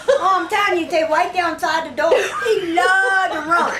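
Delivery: can't hold the laugh in, keeps talking; laughing while telling. Real, not acted. Teller laughing through his own joke's reply.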